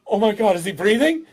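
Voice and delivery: hammed-up frantic parent voice; parent puppet show. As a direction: fret like an overworried new parent. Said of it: "Parent puppet shows are".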